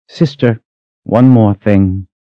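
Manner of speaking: high, firm warning call; slowing into a menacing demand; cold danger. Acted. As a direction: stop her with a dangerous edge. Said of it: "The cold danger in her own voice".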